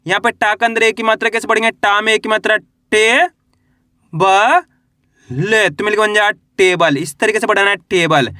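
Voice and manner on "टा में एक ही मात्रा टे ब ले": टे, ब, ले each held, with long pauses between; drawn-out teacher's spelling of टे - ब - ले; letter-by-letter spelling. Shown as a demonstration. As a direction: sound out टे, then ब, then ले, stretching each. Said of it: "मेरे साथ साथ इनको रिपीट करना".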